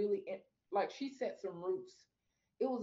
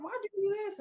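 mad at God; 13 semitones higher, 4 dB louder, faster than usual. Retold or imitated. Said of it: "'cause I was mad".

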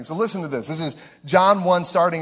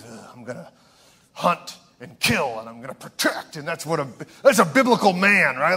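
caricature macho man. Pretend. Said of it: "biblical manhood is".

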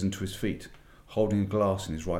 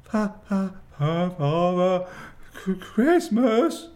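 high, loud, stuttering in terror; stammering fox; 11 semitones higher, 7 dB louder, slower than usual. A narrator's reading. Terrified fox admits the gifts to the witch, stammering. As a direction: trip over every syllable. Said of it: "stammered the fox".

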